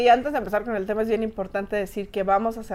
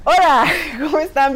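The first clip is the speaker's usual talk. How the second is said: higher, giggling through hello; laughing greeting; 7 semitones higher, 6 dB louder than usual. Real, not acted. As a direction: still giggling. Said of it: "no crean por mi risa".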